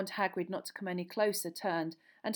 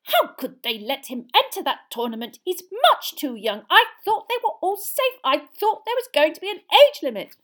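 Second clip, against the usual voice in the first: very high, loud fretting mum; anxious mother impression; 11 semitones higher, 13 dB louder than usual. Son imitating his mum worrying over the tournament, high anxious voice. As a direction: wail it like a worried mother, hammed up. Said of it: "imitated his mother's anxious voice".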